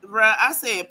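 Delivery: ordinary delivery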